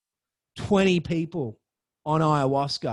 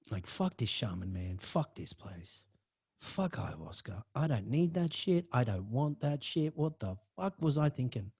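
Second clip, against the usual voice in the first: muttered disgust; 6 semitones lower, 9 dB quieter than usual. Retold or imitated. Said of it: "I'm thinking".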